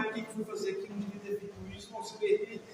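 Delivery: self-blaming inner voice. Retold or imitated. Adult punishing herself for a mistake, quiet and self-reproaching. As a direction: mutter it to yourself, ashamed. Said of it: "Ela vai se colocar de castigo".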